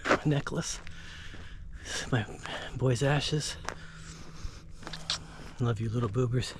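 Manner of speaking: grieving father talking to his son's ashes, soft; tender and hushed. Real, not acted. Showing his late son's ashes.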